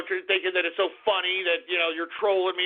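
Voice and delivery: usual speaking voice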